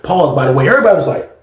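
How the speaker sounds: ordinary talk, nothing marked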